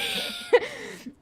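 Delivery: high burst of laughter; breaking into laughter. Real, not acted. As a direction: crack up laughing. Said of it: "can't read that with a straight face".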